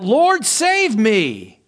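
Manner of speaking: Peter sinking, crying out to the Lord, loud and slow; drawn-out loud cry for help. A narrator's reading. Peter sinking in the waves, crying out loudly and slowly. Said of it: "he cried out".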